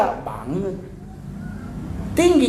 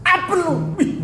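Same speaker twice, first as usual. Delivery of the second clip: sharp, much higher angry snap; angry casting line; 14 semitones higher than usual. Retold or imitated. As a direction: snap it like an actor in a rage. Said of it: "Dia bisa marah-marah". (bentakan marah yang jauh lebih tinggi)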